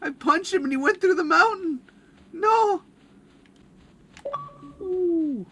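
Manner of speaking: high, loud panicked exclaim; high loud shocked yelling; shocked excitement. Real, not acted. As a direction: blurt it, half laughing. Punched pal out of map.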